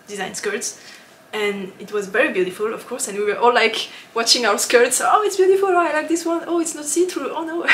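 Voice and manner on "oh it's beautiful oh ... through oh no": high-pitched, gushing exclamations in a string; imitated excited chatter. Retelling designers comparing skirts at the booth, excited. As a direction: mimic friends fussing over each other's skirts, high and excited. Acts out group admiring skirts.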